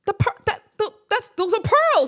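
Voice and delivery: high, loud astonished gasp of words; amazed girl. Retold or imitated. Girl seeing her father's real pearls, high astonished delight. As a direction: sound stunned and delighted, voice leaping up.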